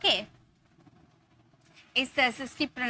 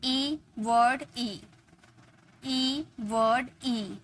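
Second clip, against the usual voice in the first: slow pattern drill; 3 dB quieter, much slower than usual. Shown as a demonstration. Teacher slowly spelling the pattern for students. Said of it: "ये सारे words आप notice कर रहे होंगे".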